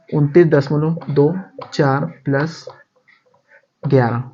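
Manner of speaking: slowed down, number read digit by digit; slow dictation. Shown as a demonstration. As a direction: say it slowly so they can copy it. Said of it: "dictating a sum to pupils".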